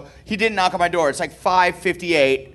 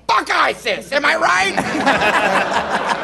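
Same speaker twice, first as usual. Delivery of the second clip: put-on drunk cheering; 7 semitones higher, much slower than usual. Drunk dad cheering with Marines.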